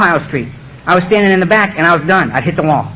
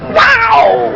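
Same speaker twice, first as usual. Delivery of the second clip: startled. Retold or imitated. Startled at being called out.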